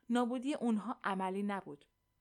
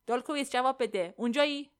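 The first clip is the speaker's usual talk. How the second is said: loud, higher-pitched call over the comm; raised voice, calling someone who isn't answering; 3 semitones higher, 7 dB louder than usual. A narrator's reading. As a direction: call him, demanding an answer.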